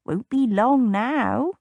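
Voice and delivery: put-on slow, knowing old stableman; lightly voiced old man, unhurried; a hint of a wise old countryman; sage old groom. A narrator's reading. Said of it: "Owen said sagely".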